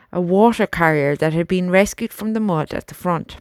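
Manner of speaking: plain reading voice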